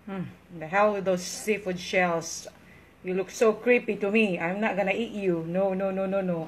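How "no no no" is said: normal talking voice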